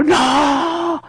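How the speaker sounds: grito agudo de súplica; grito angustiado. Retold or imitated. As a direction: clama "no" al Señor que se aleja. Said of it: "Y le grito". (grito agudo de súplica)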